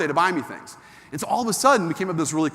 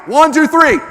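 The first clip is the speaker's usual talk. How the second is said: much higher, much louder alarmed shout; shouting stop; 10 semitones higher, 13 dB louder than usual. Real, not acted. Speaker stopping audience touching knees, a loud shout. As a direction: stop the crowd in a panic.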